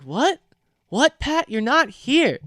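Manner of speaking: high-pitched baffled questions; incredulous. Retold or imitated. Baffled friend telling Pat he is not here, incredulous. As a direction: retell disbelief, pitch jumping up.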